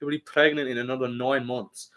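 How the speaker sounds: put-on cocky Irish fighter accent; Conor's Irish accent. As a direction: play a brash Irishman laying down plans. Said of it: "that's what he's saying".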